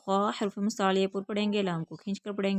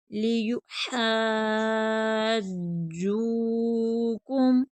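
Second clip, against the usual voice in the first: लियुहाज्जूकुम with हा held very long, then long जू; five-alif madd; 3 dB louder, much slower than usual. Shown as a demonstration. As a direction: stretch हा in लियुहाज्जूकुम to the full five counts. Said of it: "पाँच अलिफ की मिक़दार". (लियुहाज्जूकुम, पाँच अलिफ खींच कर)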